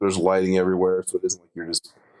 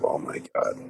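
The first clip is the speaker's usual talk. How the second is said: breathless whispered gasp of dread; whispered oh my God; 6 semitones lower, 6 dB quieter than usual. Retold or imitated. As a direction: whisper it under your breath in fear. Panicked thought during the swim back.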